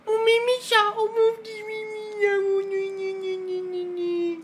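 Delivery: put-on very high, cooing baby talk; baby talk to a cat. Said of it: "moi on parle pas comme ça". (voix bêtifiante pour un chat)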